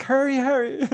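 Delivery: high, loud urging; high urgent quote of a nervous friend. Retold or imitated. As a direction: rush him along. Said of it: "she's like".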